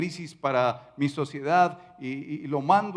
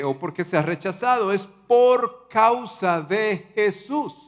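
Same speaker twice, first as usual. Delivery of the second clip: loud, high-pitched impassioned proclamation; fervent preaching; 6 semitones higher, 3 dB louder than usual. Acted. Pastor drives home the point to the congregation, fervent preaching. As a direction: preach it out with full conviction.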